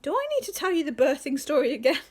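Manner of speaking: high, loud mock-exasperated mum; mum's threat. Robin's mum warning Robin, loud mock exasperation. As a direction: call it out like a mum at the end of her tether. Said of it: "she's just said to Robin".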